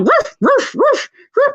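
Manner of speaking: high yappy barks; dog barking. Retold or imitated. Little dogs running at her, high yappy barking. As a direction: yap like a pack of little dogs. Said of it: "and they come".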